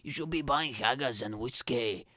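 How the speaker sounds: hammed-up Scottish accent; exaggerated Scottish scolding; mock Scottish. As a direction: make fun with a put-on Scottish voice. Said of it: "I make fun of Scotland".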